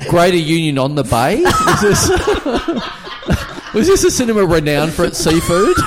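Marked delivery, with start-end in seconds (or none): 1.4-5.8 laughing incredulous. Real, not acted. Mock-incredulous question about the cinema.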